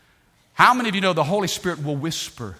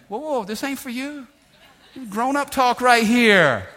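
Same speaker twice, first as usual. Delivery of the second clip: high-pitched acted parent warning, building louder; parent shooing kids; 7 semitones higher, 3 dB louder than usual. Dad to kids leaning in on grown-up talk, mock scolding. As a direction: shoo nosy little listeners away, stretching the last words.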